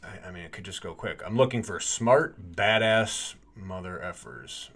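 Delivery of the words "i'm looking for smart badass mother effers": booming announcer read, slowed at the end. Shown as a demonstration. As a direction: hype it up like an announcer. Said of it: "Should I try and use an announcer voice?".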